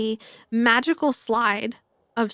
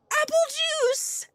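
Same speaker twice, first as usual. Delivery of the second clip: mock shout; 3 dB quieter, slower than usual. First girl calling her drink from the slide, high mock shout. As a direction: sing it out high as if calling from the top. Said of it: "and she shouts".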